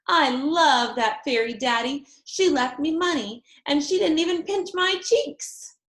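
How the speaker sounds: put-on high, eager little girl voice; girlish Junie B. A narrator's reading. Little girl telling daddy about the fairy, gushing. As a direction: gush like a delighted kid.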